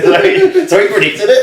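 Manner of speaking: high, loud laughing; can barely get it out for laughing. Real, not acted. Telling co-host the neighbour was right, laughing loud and high.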